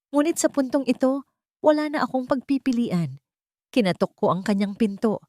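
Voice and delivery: usual speaking voice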